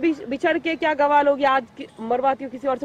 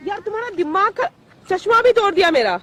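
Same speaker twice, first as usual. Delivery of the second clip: high-pitched, upset accusation; angry complaint; 8 semitones higher, 4 dB louder than usual. Real, not acted. Woman accuses man of breaking her glasses, angry. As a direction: blame him with rising indignation.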